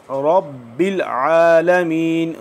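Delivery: आलमीन drawn out slowly, the ain from the throat; drawn-out recitation. Shown as a demonstration. Teacher recites रब्बिल आलमीन slowly to students, drawn out. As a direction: stretch आलमीन long and slow. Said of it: "इसको थोड़ा सा खींच के पढ़ेंगे".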